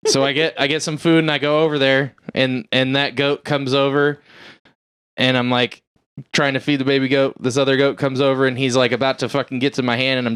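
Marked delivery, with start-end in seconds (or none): none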